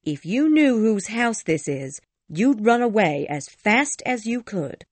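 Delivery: high, urgent warning; very serious. A narrator's reading. Ellen warning Cathy about the house, very serious. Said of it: "said Ellen very seriously".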